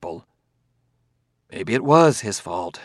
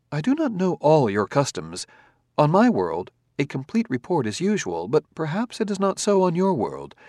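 higher, even, polite robot; calm robot voice; 4 semitones higher than usual. Acted. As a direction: speak as a courteous, precise android. Robot character held voice.

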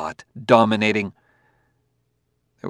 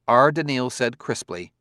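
ordinary delivery

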